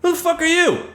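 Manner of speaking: high, incredulous outburst; answering a doubter in chat, high and incredulous; mock indignant shock. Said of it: "I'm like".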